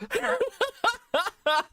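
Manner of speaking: very high breathless laugh; real helpless laughter; high cackle. Real, not acted. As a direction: burst out laughing. Cracking up.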